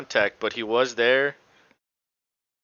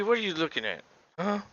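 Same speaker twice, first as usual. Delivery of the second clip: higher-pitched aside to Abby; playful question; 8 semitones higher than usual. Real, not acted. Commentator turns to ask Abby what she's looking at, playful high voice. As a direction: ask it high, voice falling away at the end.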